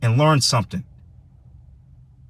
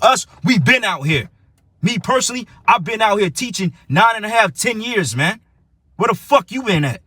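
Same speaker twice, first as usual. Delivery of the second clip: much higher, heated; fired-up boast; 7 semitones higher than usual. Real, not acted. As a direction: demand where they've been. Boasting years of preaching, angry.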